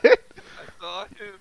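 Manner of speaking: excited. Real, not acted. Excited about seeing the ghost.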